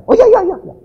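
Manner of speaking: high, loud burst of delight; overjoyed child saying yes; eager agreement. Acted. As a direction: jump at the offer. Child eagerly accepts uncle's offer.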